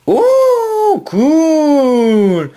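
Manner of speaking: very high, loud delighted squeal; excited squeal. Real, not acted. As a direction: squeal with joy at the card. Excited card pull. (cri aigu de joie)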